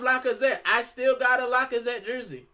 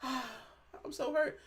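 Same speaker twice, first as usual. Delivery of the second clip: softer, very slow hammed-up heartbreak with a sigh; mock crying; 4 semitones higher, 9 dB quieter, slower than usual. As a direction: fake a heartbroken fan, sighing. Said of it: "I'm not gonna cry and go".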